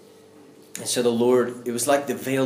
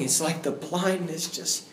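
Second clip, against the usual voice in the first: ordinary delivery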